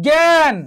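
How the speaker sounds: জ্ঞান said with an opening nasal g, high and drawn out; nasal g sound. Shown as a demonstration. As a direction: demonstrate জ্ঞান starting on a nasalised g. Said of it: "গ, উপরে কি? চন্দ্রবিন্দু।".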